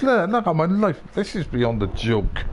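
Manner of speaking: ordinary talk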